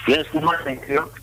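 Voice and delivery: normal talking voice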